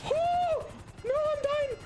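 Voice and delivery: very high, panicked squeal of a dying character; hammed-up death squeal; mock squeal. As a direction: squeal it like a cartoon victim.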